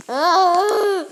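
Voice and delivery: loud, high laughing shriek; startled laughing yell; laughing scream. Real, not acted. Laughing scream when attacked.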